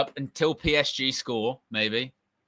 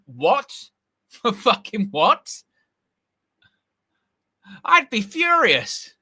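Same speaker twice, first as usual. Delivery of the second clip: high, slow, half-laughing indignation; mock fury at the ref; laughing disbelief; laughing outrage; 6 semitones higher, 4 dB louder, slower than usual. Real, not acted.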